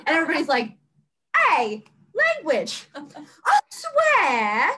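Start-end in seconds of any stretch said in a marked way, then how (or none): 1.5-4.7 slow, drawn-out British reprimand; mock British scolding. Everybody scolding Asa's swearing, mock British. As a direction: scold in a hammed-up British accent. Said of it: "mock them in a British accent".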